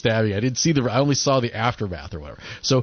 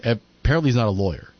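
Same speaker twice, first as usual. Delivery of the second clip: ordinary talk, nothing marked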